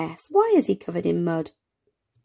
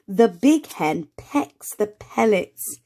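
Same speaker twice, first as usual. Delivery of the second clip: slow reading aloud; slower than usual. Shown as a demonstration. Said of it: "Shall we say it together".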